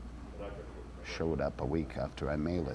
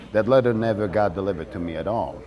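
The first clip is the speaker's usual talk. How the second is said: ordinary talk, nothing marked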